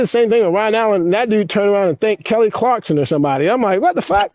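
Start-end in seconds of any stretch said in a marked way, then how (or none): 3.8-4.3 high-pitched, indignant disbelief; mock outrage. Skater telling of a mistaken thank-you, comically mad. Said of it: "I'm so mad".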